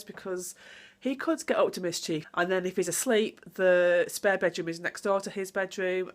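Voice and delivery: normal talking voice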